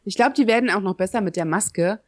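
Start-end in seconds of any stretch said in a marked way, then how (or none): none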